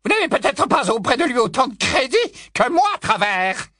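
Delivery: high, haughty witch voice; lofty and scornful; Bellatrix's haughty tone. Acted. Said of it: "imitation du ton le plus hautain de Bellatrix".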